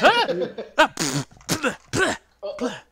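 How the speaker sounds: yelp, then spit out a bad taste; sputtering raspberry noises of disgust; acted spitting and blehs; disgusted spluttering. Boy stops licking a face.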